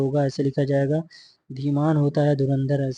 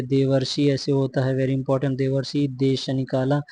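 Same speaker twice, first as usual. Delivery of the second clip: ordinary talk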